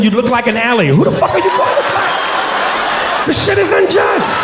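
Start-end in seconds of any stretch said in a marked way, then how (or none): 0.9-3.3 high, slow, strangled shout of mock outrage; drawn-out high squeal of comic indignation; strained outraged yell. 3.3-4.4 high, slow mock-wronged shout; drawn-out comic complaint; mock outrage. Continues the strained mock outrage.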